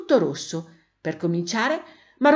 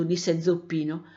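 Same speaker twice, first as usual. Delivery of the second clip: ordinary delivery, nothing marked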